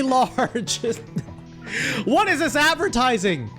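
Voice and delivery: ordinary delivery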